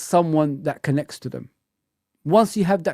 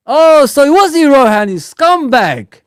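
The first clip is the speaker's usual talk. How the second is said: mock outrage; 8 semitones higher, 8 dB louder than usual.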